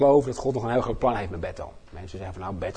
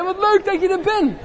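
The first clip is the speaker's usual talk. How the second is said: high, cheerful welcome to a neighbour; friendly greeting; 4 dB louder than usual. Shown as a demonstration. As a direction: greet them like an old friend. Modelling greeting again.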